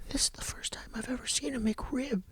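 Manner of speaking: put-on very soft child-in-awe whisper; hushed, breath-only marvelling; awed whisper. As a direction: whisper it in wonder.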